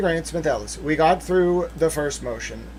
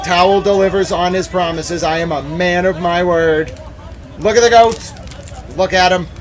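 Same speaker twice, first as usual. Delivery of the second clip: hyped up; 3 semitones higher, 6 dB louder than usual. Real, not acted. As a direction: sell the goat videos like a carnival barker. Hyping goat videos for chat.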